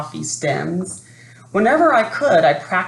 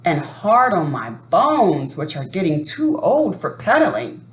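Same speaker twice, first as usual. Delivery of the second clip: plain reading voice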